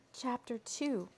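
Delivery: plain reading voice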